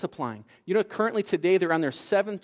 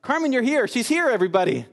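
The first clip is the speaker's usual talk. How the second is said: higher-pitched, delighted announcement to the room; excited welcome; 6 semitones higher, 4 dB louder than usual. Retold or imitated. Jocelyn's family greets Carmen at the door, excited. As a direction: imitate an eager host calling out. Acted host greeting arriving guest.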